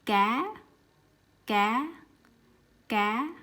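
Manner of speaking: "cá" with light unaspirated c and rising tone; Vietnamese cá. Shown as a demonstration. Teacher modelling the word for fish. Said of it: "the example I have for you is".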